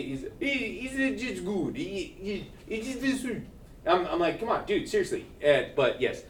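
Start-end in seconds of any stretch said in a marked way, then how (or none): 0.0-3.2 mild imitation of the Italian teacher's accent, higher; lightly put-on Italian accent, pitched up. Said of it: "very thick Italian accent".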